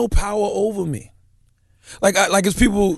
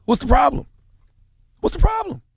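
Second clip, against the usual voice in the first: rising exasperation; 10 semitones higher than usual. Real, not acted. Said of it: "repeats, louder and higher the second time".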